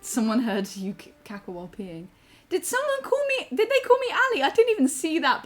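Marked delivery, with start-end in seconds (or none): 2.5-5.4 very high, squealed surprise; startled disbelief. Real, not acted. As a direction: shoot the pitch up in surprise. Startled on hearing called Ali.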